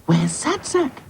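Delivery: high-pitched dainty alarm; Princess, worried cry. Acted. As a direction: fret aloud in a high doll voice. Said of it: "she cried".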